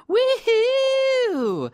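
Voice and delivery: high excited whoop; big celebratory woohoo; cheer. Real, not acted. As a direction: whoop it up to open the show. Cheering show open.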